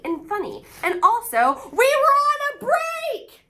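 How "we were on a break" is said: high, loud indignant cry; Ross's classic yell. Retold or imitated. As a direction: protest like Ross defending himself. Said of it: "That is a classic line".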